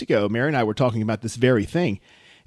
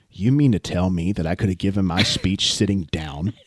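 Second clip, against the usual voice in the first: lower, incredulous whisper-like aside; a lower mock aside from the bride; imitating Katie; 8 semitones lower than usual. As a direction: lean in and ask in disbelief. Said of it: "She leans over to me and goes".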